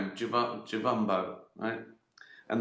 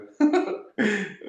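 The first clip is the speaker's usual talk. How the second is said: laughing detective; 10 semitones higher, 4 dB louder than usual. Pretend. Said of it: "and I go".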